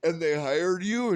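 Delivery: high, loud what the fuck; stunned disbelief. Retold or imitated. Comedian reacting to the drunk captain, shocked and loud. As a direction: exclaim in shock.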